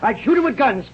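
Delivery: high, swaggering battle boast; gleeful rant. Acted. Rat killer boasting to the children, high gleeful swagger. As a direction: crow about the slaughter to come.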